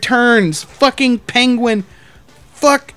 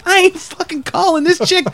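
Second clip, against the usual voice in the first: higher-pitched mock indignant refusal; 7 semitones higher than usual. Manager refusing the drunk man's demand, retold indignant pushback. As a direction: act out a heated refusal.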